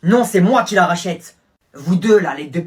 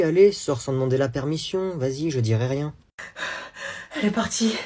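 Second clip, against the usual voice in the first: ordinary talk, nothing marked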